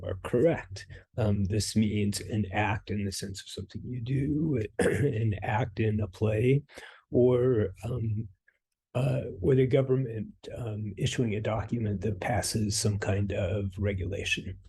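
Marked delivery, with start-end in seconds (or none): none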